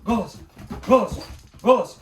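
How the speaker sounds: loud, firm command to a dog. Real, not acted. Commanding puppy to give voice. (твёрдая громкая команда собаке)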